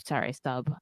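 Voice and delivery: normal talking voice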